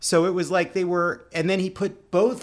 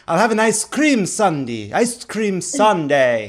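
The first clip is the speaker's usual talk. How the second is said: imitating the actor, a touch of pride and novelty, slow; proud novel order; 3 semitones higher, 5 dB louder than usual. Ordering dessert at dinner, mock proud and novel. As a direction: savour each word like a rare treat. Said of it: "he said it with a kind of pride and novelty".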